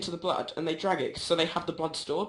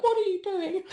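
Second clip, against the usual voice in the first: put-on high childish confusion; little boy wondering what's happening; puzzled little boy; 14 semitones higher than usual. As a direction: squeak it like a baffled kid. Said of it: "he just looked at me like".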